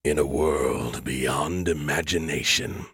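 deep, slow trailer announcer; movie trailer voice. Retold or imitated. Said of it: "a deep voice saying".

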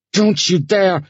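high, loud burst of protest; hoarse shout. Acted. Sasha shouting at the man grabbing her strap, hoarse. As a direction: shout it hoarsely, jerking away. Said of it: "she shouted hoarsely".

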